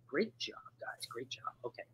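unvoiced whispered praise. Real, not acted. Host praising quiz players under breath, in a whisper.

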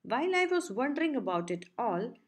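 ordinary delivery, nothing marked

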